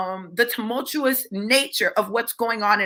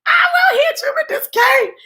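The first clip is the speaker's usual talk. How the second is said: very high, hammed-up cry; imitated frantic warning, high-pitched; retold panicked threat; 4 dB louder than usual. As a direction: squeal his threat in mock alarm. Acting out his panicked threat.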